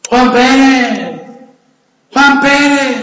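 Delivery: long drawn-out mock call; acted shout. Gringos luring a Mexican soldier out, drawn-out shouting. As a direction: holler the name across the trenches, stretched out. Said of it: "Y gritan".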